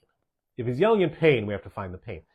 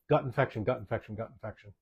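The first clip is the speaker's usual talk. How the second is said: "gut infection" repeated, each softer; fading repetition; 3 semitones lower, 4 dB quieter than usual. Shown as a demonstration. Host stressing the diagnosis to parents, fading repetition. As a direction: fade "gut infection" out over three takes. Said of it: "I cannot emphasize that enough".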